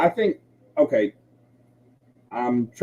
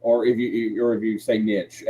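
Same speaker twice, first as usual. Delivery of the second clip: ordinary delivery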